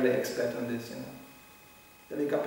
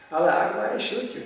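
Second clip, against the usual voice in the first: louder, then higher mock threat; mock zealot threat; 6 semitones higher, 4 dB louder than usual. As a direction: toss it out like a cheerful gunman. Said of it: "That's the mood".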